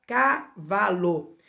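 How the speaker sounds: CAVALO in three beats, ca-va-lo; split into syllables. Shown as a demonstration. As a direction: separate each syllable of cavalo. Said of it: "três pedacinhos de som".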